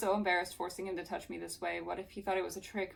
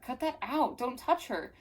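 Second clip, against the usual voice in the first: higher, sharp scolding; acted protest; 6 semitones higher than usual. Melanie telling Jared to stop, higher indignant voice. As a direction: order him off indignantly.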